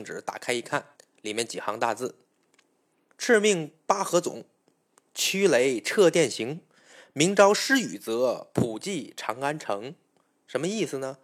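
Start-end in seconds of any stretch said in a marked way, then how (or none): 3.2-9.9 slow, measured reading of an imperial decree; edict proclamation. Acted. Jade Emperor's edict read to the Dragon King, slow formal proclamation. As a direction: read it out solemnly, stretching the phrases.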